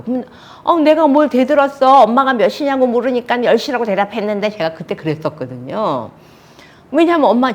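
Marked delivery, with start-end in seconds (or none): 0.6-4.5 high, loud indignant retort; defiant daughter. Retold or imitated. Daughter to mother accusing her of talking back, defiant. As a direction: protest hotly as the accused daughter. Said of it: "반항이 있었던 거죠".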